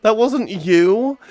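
high, drawn-out, rising disbelief; shocked disbelief. Real, not acted. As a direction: stretch it slowly upward in shock. Shocked reaction to anonymous gift.

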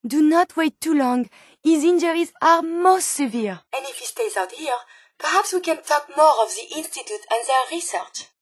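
usual speaking voice

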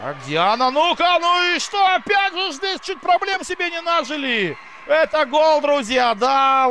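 high, loud live goal shout; excited hockey goal call. Real, not acted. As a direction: yell the goal to the crowd. Surprise goal, commentator shouts.

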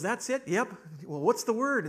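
acted cocky surprise at an easy test; eager ex-husband. Ex-husband asks his ex-wife for the word, eager.

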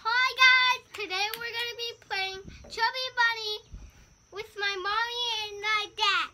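vlog intro. Acted.

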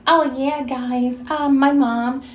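lightly mock her put-on voice. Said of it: "One minute you're like".

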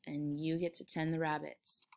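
low-key, quiet confirmation; subdued agreement. Acted. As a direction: repeat it quietly to humor him. George echoes Lenny quietly.

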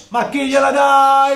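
loud, slow furious shout; angry shout. Real, not acted. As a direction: yell at him in disbelief. Angry at teammate's pass.

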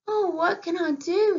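high, loud, pleading despair; despairing plea. Acted. Heron mother to the mongoose, despairing over her eggs. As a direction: wail it like a heartbroken mother bird.

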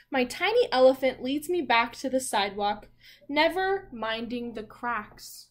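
put-on reading a story aloud to young children. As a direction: read it out to the class like a picture book. Reads the picture book aloud.

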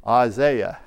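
British-style "Isaiah" with ZAY in the middle; British accent. Shown as a demonstration. Preacher offering the congregation "Isaiah" in a British accent. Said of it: "if I say it with that British accent".

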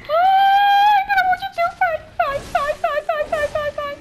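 high desperate pleading shrieks; frantic screaming. Real, not acted. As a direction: scream at it to get off. Begging creature to get off.

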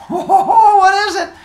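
very high, loud, gleeful outburst; hammed-up squeal of eager wonder; giddy excitement. Phaethon asking dad about his present, giddy high squeal.

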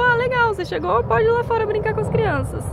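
high, bright imitation of the boss's offhand welcome; breezy boss quote. Supervisor brushing off her question, in a high, airy mock voice.

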